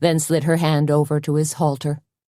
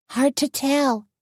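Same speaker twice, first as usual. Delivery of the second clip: higher, lightly voiced friend; a higher lilt for Tony; Tony's voice; 10 semitones higher, slower than usual. A narrator's reading.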